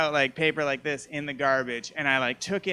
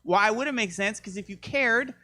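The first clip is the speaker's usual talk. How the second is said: rising rant; 7 semitones higher than usual. Real, not acted. Escalating rant about the phrase.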